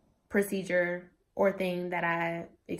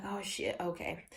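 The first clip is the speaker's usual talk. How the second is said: much softer, a nervous under-the-breath gulp; scared hush; 2 semitones lower, 10 dB quieter than usual. Retold or imitated. Scared patient hearing about the pain, softly murmured. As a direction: let the fear drain the voice to nearly nothing. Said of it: "So I'm just like".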